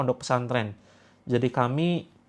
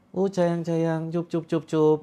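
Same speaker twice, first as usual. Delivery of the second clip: acting cute; 3 semitones higher, slower than usual. Husband acting cute to hush his wife, gentle baby talk. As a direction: play it cute, shushing a crying wife. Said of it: "sok imutlah di depan istri".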